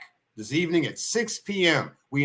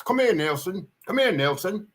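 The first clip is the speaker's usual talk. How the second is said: put-on higher calling voice with a light Australian or New Zealand accent; calling the dog, hint of Aussie accent; 6 semitones higher than usual. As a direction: call the dog across the field with a touch of an Australian accent. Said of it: "I don't think it's an English accent".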